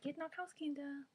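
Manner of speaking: put-on sing-song gentle German to the kids. Shown as a demonstration. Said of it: "I guess you could say it like".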